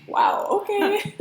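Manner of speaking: slow, high, laughing sarcasm; drawn-out sarcastic wow. Retold or imitated. Daughter mocking being brushed off, laughing. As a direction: stretch it out, laughing in disbelief. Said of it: "It's like".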